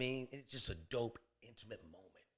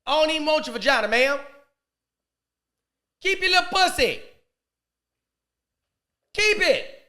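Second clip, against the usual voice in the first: high-pitched, loud comic outburst; mock indignant shout; 12 semitones higher, 10 dB louder than usual. Comedian imagining himself turning the woman down, mock indignant shout. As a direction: shout it high, holding the last words.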